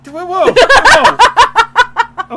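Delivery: drawn-out, loud laughing whoa. Real, not acted. Husband laughing, calming the others down. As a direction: stretch it out through laughter.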